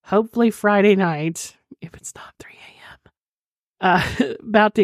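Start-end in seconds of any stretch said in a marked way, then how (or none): 1.8-3.1 unvoiced hushed aside; whispering. Real, not acted. Late-night aside, whispered.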